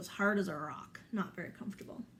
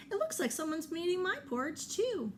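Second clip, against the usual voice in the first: higher, quicker mother bear voice; light, high mama bear complaining; 9 semitones higher, faster than usual. Acted.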